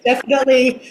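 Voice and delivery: amused, laughing as she talks; chuckling at how absurd it was. Real, not acted. Guest laughing to the host about a film she never saw. Laughing at her own story.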